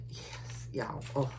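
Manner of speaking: breathy unvoiced yes, very soft; excited whisper. Real, not acted. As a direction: hiss it like a secret thrill. Excited reaction to demons arriving.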